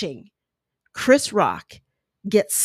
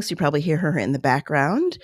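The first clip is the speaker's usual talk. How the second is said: ordinary talk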